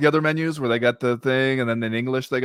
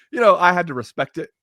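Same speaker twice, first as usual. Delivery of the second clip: normal talking voice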